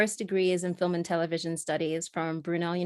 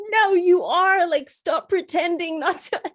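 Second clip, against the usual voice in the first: high, loud mock protest; insistent drunk guy; 11 semitones higher, 7 dB louder than usual. Drunk guy accusing elevator operator of pretending, loud high imitation. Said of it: "And he was like".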